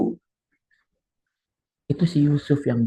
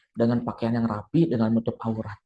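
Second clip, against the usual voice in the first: ordinary delivery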